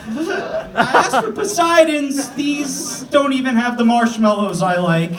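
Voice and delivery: put-on high, slow whining child; whiny kid. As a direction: whine at mom like a spoiled kid. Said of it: "you're like".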